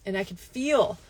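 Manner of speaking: ordinary delivery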